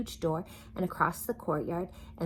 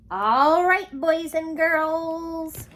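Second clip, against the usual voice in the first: sing-song sign-off; 11 semitones higher, 9 dB louder, much slower than usual. Real, not acted. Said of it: "signing off to children".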